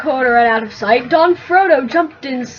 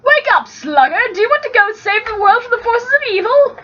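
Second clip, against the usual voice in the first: rousing call; 9 semitones higher, faster than usual. Acted. Frodo rousing Sam.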